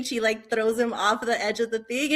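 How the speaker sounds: ordinary delivery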